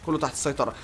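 usual speaking voice

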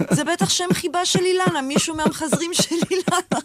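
high, innocent mother's explanation; naive mother voice. Retold or imitated.

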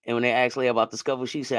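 usual speaking voice

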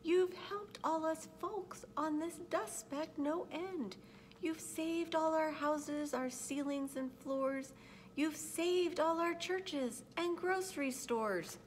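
high, thin-sized little creature voice; grateful little voice, high and careful; tiny speck voice. A narrator's reading. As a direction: speak as someone very small, pitched high and measured. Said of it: "The voice was so faint".

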